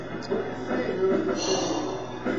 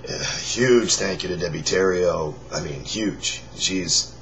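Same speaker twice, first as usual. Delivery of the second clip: hoarse, raspy near-whisper; forcing air through a worn-out voice; lost voice. Real, not acted. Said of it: "This is me with no voice".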